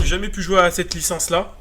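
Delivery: ordinary talk, nothing marked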